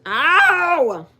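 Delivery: sharp loud yelp of pain; sudden ow. Real, not acted. Owner bitten by her puppy, loud yelp. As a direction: yelp as the dog bites.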